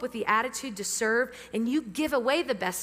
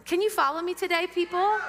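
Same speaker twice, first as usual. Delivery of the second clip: higher and louder, rousing the room; louder call-out; 6 semitones higher than usual. Shown as a demonstration. Pastor rousing a quiet congregation for a reply, louder call. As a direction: call it out to wake them up. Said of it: "Some of you are quietly nodding".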